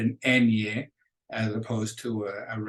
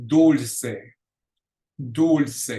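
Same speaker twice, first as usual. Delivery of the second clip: dulce with DUL- punched louder; stress on second-to-last syllable; 2 semitones higher, 5 dB louder than usual. Shown as a demonstration. Teacher showing the accent rule, dulce stressed hard on DUL-. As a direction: hit the first syllable of dulce, let the second fall. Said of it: "second to the last syllable".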